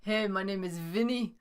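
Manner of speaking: bad New York accent impression; hammed-up New York guy voice. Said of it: "I can't even do a New York accent".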